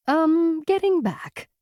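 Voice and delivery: high, hesitant, sheepish admission. A narrator's reading. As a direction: hedge it, a bit embarrassed. Awkward admission of uncertainty.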